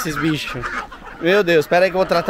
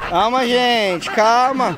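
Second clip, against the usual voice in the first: calming; 6 semitones higher, much slower than usual. Real, not acted. Calming the animals.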